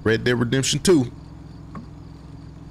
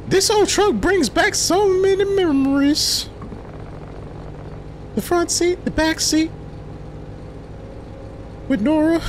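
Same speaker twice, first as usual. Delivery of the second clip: high friend voice. Acted. Voicing friend character.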